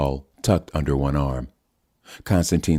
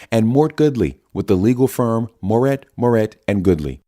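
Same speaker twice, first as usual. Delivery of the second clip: bright, higher TV-anchor delivery, a bit louder; news anchor read; 6 semitones higher, 4 dB louder than usual. Acted. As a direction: open the broadcast like a cable news host. Anchor opens live news broadcast.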